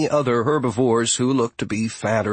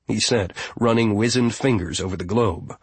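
plain reading voice

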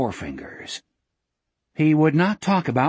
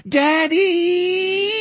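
very high, long cry; drawn-out, high-pitched child's shriek; chilling rising scream. Acted. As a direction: scream for daddy in terror. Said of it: "screamed in a chilling, rising voice".

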